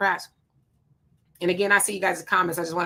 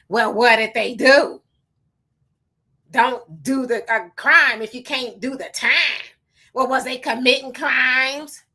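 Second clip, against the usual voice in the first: heated shout; 3 semitones higher, 4 dB louder than usual. Real, not acted. Arguing hard against Kamala critics.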